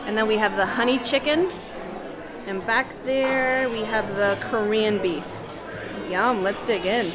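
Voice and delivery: ordinary delivery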